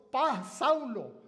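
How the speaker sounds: a lightly voiced heavenly Jesus, calling slowly. Preacher voicing Jesus calling Saul on the road, slow and solemn. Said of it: "oyó la voz".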